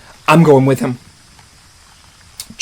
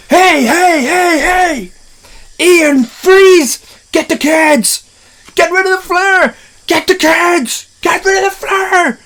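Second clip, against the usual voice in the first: frantic shouting; slower than usual. Retold or imitated. Men yelling at each other during the T-Rex attack, frantic high shouts. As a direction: shout orders over the chaos.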